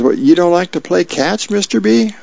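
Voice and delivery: put-on high-pitched kid asking in surprise; climbing, childlike question; little kid. A narrator's reading. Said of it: "little kid peeks his head in".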